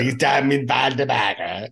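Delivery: imitating a drunk hotel clerk's odd tobacco; mock drunk lobby clerk reading the slogan; odd drunk clerk drawl. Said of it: "He says it in some weird ass way".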